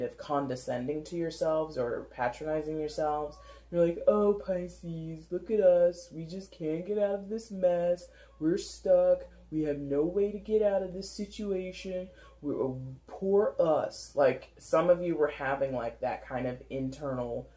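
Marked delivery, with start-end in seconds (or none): 4.1-14.1 lightly higher, a hint of whiny mockery; mildly mock the self-pitying inner voice. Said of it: "were mocking yourselves".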